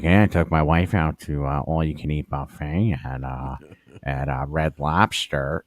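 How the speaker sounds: put-on a mild take on the annoying old guy; old coworker impression. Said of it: "I do a perfect impression of him".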